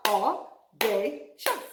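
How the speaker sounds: audacious slowly, split au-da-cious; clapped-out syllables. Shown as a demonstration. Teacher claps out the syllables of audacious. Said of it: "Let's clap it out".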